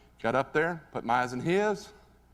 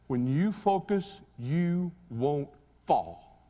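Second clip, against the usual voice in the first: slow, deliberate; much slower than usual. Retold or imitated. Coach delivers lesson slowly.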